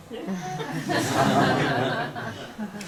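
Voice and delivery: a long, soft laugh trailing off; soft breathy laugh. Real, not acted. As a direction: let a quiet laugh roll out. Laughs at the punchline.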